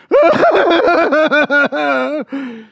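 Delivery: loud high wailing ugly cry; hammed-up ugly crying wail; mock ugly crying, big and loud; demo of ugly crying. Shown as a demonstration. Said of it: "maybe that was a little bit funny, me doing that".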